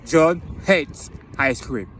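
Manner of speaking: very slow, word by word with gaps; spaced-out teaching delivery; slow model sentence. Shown as a demonstration. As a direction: say it slowly for learners to repeat. Repeats example sentence for learners.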